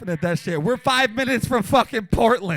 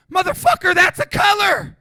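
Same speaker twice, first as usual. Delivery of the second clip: loud, high shout; punchline hollered much higher and louder; 8 semitones higher, 6 dB louder than usual. Real, not acted. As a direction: yell it at the crowd. Punchline yelled at crowd.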